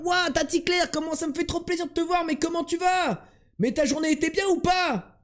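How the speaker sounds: very high, gushing over-the-top welcome; fake excited voice. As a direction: squeal it like a thrilled auntie. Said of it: "je vais faire".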